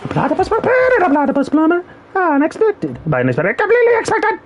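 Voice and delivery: high, raspy cartoon villain voice; Doofenshmirtz impression. Streamer performing his villain impression for chat, high and raspy. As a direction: squeeze the voice up into a zany evil scientist. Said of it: "Can I get my voice raspy enough?".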